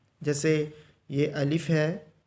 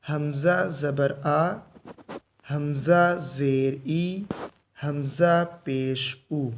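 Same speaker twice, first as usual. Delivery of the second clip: calm drawn-out letter drill; slow drill recitation; much slower than usual. Shown as a demonstration. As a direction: recite gently, no jerk. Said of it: "झटका दे के नहीं पढ़ना ... आराम से". (आराम से धीमा सबक़)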